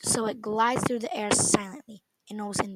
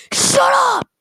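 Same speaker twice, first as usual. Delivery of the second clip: roaring, much higher, much louder shout; lion voice shout; 12 dB louder than usual. Acted. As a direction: roar it like a lion. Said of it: "I shouted in that lion voice again".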